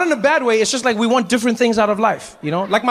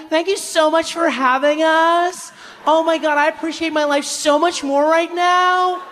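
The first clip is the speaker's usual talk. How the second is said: much higher, slow, gushing tourist voice; 9 semitones higher, slower than usual. Retold or imitated. Tourists gushing over a stranger's home, slow and high. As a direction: gush like clueless tourists. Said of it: "tour group were like".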